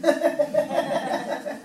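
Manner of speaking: big loud burst of laughter; hearty laugh. Real, not acted. Telling the audience her story, loud hearty laugh.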